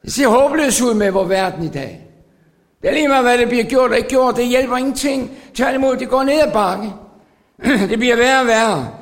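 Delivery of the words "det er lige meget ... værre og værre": fast, higher lament; fervent sermon voice; urgent preaching. Acted. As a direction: rush through the hopeless picture. Fast lament over world.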